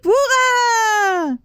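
high, slow, drawn-out cheer; cried. Acted. Grand-papa Joe cheers for Charlie while dancing, high slow shout. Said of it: "cria-t-il".

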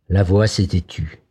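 ordinary delivery, nothing marked

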